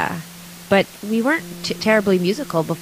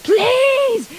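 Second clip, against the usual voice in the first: much higher, drawn-out mock mom plea; mom yelling; much slower than usual. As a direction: imitate mom begging from downstairs, slow and high. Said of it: "My mom would come home and be like".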